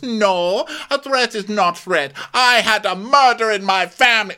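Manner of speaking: high and loud; mock Diana. Podcaster mocking Diana's murder claim, high and loud. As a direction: insist shrilly. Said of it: "She goes".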